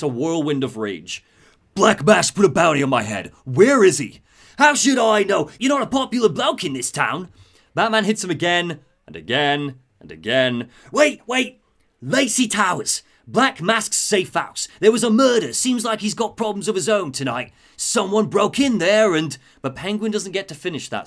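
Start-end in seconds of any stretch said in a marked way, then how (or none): 1.8-4.2 loud, demanding hero voice; Batman interrogating. Acted. Acted Batman interrogating Penguin. 4.6-7.3 loud, high Cockney retort; Penguin under interrogation. Acted. Acted Penguin answering under interrogation. 10.9-19.4 high, loud Cockney pleading; beaten Penguin confessing. Acted. Acted Penguin giving in after blows.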